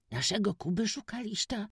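put-on high, moved boyish cry; moved exclamation. A narrator's reading. Witek cries out to the stranger about Kuba, moved. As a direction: cry out, touched. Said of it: "zawołał Witek wzruszony".

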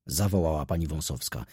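plain reading voice

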